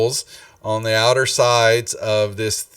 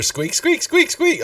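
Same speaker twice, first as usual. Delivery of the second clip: squeak, squeak, squeak pitched way up high; squeaking rat; slower than usual. Acted.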